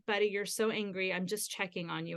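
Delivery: soft, low-key check-in; quiet, tender parent voice; gentle reassurance. Retold or imitated. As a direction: reassure an angry child without crowding them. Checking gently on angry son.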